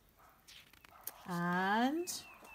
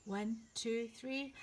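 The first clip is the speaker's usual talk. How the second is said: ordinary delivery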